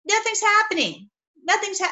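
high, strained cry that nothing works; mock panic. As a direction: play the prophets giving up in dismay. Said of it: "They're like".

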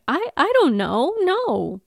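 mildly distressed Willow, lifted pitch; shaken, unsure denial; upset Willow. Retold or imitated.

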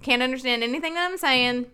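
mock hillbilly accent, words slurred together. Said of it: "all my words are just slurred together".

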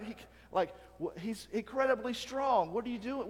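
usual speaking voice

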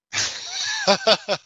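a high, disbelieving burst of laughter; incredulous laugh. Real, not acted. Amused by the oddly specific offer, incredulous laugh. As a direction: laugh at how absurd it is.

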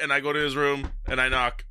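usual speaking voice

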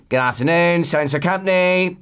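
mock bored company greeting; bored gatekeeper tone. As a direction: answer the phone like a weary receptionist. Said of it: "probably in that exact tone".

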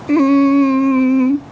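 loud squeaky hum for tomato; loud high hum. Shown as a demonstration. For the stranger, a loud high soup hum. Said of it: "see my cream of tomato".

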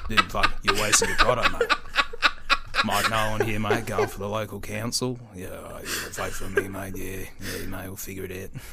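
low Australian smoker talking through a drag; deep, low-pitched Aussie mate; Mike Nolan. Acted. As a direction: keep it down low, as if sucking on a cigarette. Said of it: "I think I can do a good, you know, uh, Mike Nolan".

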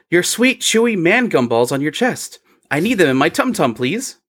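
put-on high, sweet childlike begging; cutesy baby-talk plea; inappropriately cheerful. A narrator's reading. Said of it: "Fro repeats a statement".

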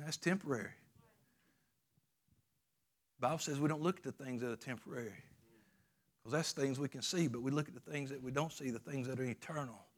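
hushed sermon voice. Acted. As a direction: pull the room in close and gently. Preacher drops to a hush.

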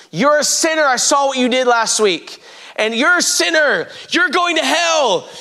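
high-pitched imitation of an accuser calling out sinners; accusing condemnation. Man on a table condemning a crowd of Christians, high accusing cry. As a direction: point and condemn, voice pitched well up. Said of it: "this man stands up on a table and he starts".

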